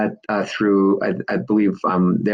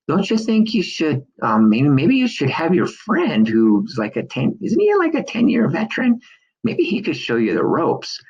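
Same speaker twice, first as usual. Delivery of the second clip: higher, lightly voiced worried wife; a hint of a cautious wife, higher voice; wife's worried suggestion; 7 semitones higher than usual. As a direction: imitate the wife gently talking him out of it. Wife doubting his plan.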